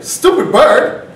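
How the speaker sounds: loud, high, slow echo of the insult; seagull taking exception. Acted. Seagull answers the driver's insult, loud indignant echo. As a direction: throw STUPID BIRD back, drawn out and high. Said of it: "He's like".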